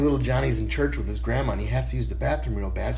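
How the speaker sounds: ordinary talk, nothing marked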